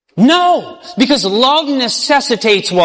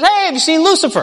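higher, curious angel voice; Gabriel asking casually; 7 semitones higher, faster than usual. Acted. Gabriel asking an angel about Lucifer, higher and curious. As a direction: call out to a fellow angel.

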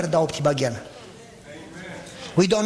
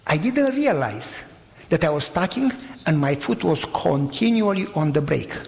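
normal talking voice

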